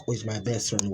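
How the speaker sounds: ordinary delivery, nothing marked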